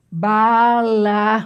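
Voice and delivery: "balaustre" stretched as ba-la-us-tre; syllable by syllable. Shown as a demonstration. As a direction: draw out each syllable of "balaustre". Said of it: "a sílaba tônica é o U".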